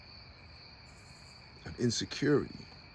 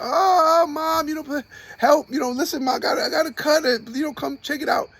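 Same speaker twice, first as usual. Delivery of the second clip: put-on high, loud childish whine; whiny hurt kid; 5 dB louder than usual. Imitating himself as a hurt kid crying for his mom, high whine. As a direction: whimper like a kid with a scratch. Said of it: "you're like".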